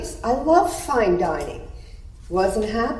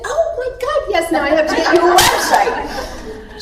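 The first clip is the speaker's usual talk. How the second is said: much higher, a squealed "Oh my God"; thrilled; 9 semitones higher than usual. Retold or imitated. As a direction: gush with delight at the idea. Retells her own thrilled reply.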